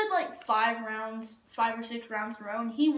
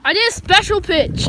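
excited boast; 6 semitones higher, 13 dB louder than usual. Real, not acted. Boasting about a special throw.

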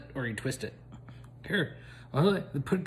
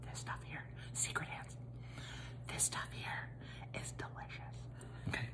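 secretive whisper; 19 dB quieter than usual. Real, not acted. Whispering to viewers about the secret product.

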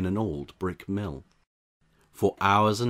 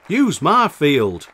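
loud, slow, hearty farmer; slow, loud and higher farmer's offer; 8 semitones higher, 13 dB louder, slower than usual. A narrator's reading. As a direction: boom it out like a generous farmer. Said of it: "Farmer Seed said".

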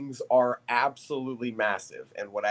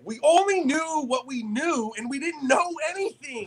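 mock quote; 10 semitones higher than usual. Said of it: "I made this joke on my live".

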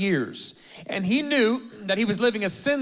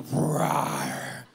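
put-on growling kid; 3 semitones lower, 5 dB quieter than usual.

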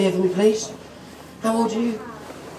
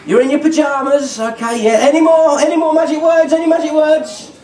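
put-on upbeat children's entertainer patter; kids party magician; 6 semitones higher than usual. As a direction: quiz the kids brightly.

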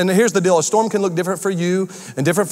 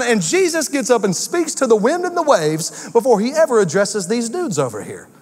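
ordinary delivery

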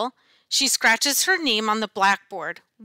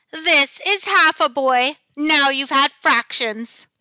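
high, loud smirking teacher voice; sly monster teacher, louder and higher; 4 semitones higher, 5 dB louder than usual. A narrator's reading. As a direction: smirk through the words like a hungry monster. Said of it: "she smirks".

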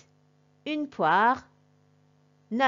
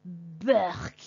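disgusted burk; 3 semitones lower than usual. Shown as a demonstration. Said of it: "you will all say together".

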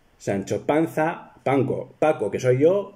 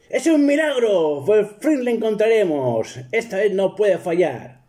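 overjoyed Don Quixote; 9 semitones higher than usual. Retold or imitated. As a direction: cry out at the miracle. (Don Quijote eufórico, mucho más agudo)

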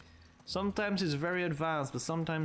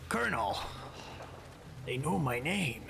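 lightly voiced Snake, half whispered; a hint of Snake's whispery delivery; Snake impression; 2 semitones lower than usual. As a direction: attempt a hushed game-hero voice. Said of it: "I can do a snake accent".

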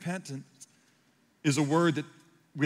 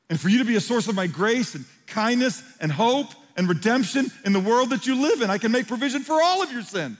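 impassioned preaching; 6 semitones higher, 4 dB louder than usual. Acted. Jesus voiced lamenting, preaching swell.

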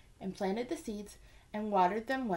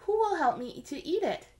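higher, lightly teasing hen; hen offering bread; 7 semitones higher, 5 dB louder than usual. A narrator's reading. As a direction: dangle the question like a treat. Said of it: "said the little red hen".

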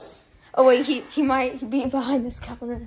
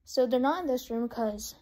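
usual speaking voice

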